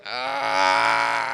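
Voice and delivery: long high "aah" of mock panic; hammed-up speechless squeal; awkward stalling noise. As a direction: hold a high, helpless wail. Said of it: "started just going".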